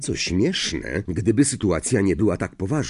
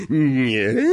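higher, drawn-out questioning echo; mocking echo; 4 semitones higher, 3 dB louder than usual. A narrator's reading. Professor echoing a pupil's protest, mocking. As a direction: echo it with suspicion.

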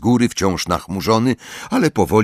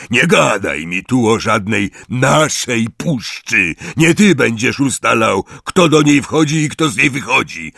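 angry rebuke; 6 semitones higher, 4 dB louder than usual. Acted. Hagrid rebukes centaur.

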